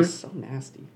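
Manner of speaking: words right after a sip of coffee; talking with coffee in the mouth; while sipping a drink. Real, not acted. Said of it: "Sorry, I'm drinking coffee".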